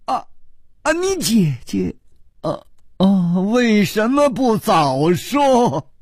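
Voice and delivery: high, stammering exclamation; blurted astonishment. A narrator's reading. Hero learns the bride is her sister, blurting astonished. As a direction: gasp it out, stretching the final question high. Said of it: "楚留香失声说".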